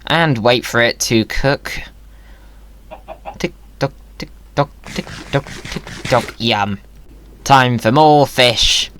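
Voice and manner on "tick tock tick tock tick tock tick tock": breathy whisper, soft and even; whispered tick tock. Pretend. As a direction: whisper it like a ticking clock. Waiting for fish to cook.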